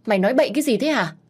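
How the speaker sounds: fast, high, loud outburst; angry retort. Acted. Mistress rushes to silence the maid, fast and furious. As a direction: cut her off in a panic.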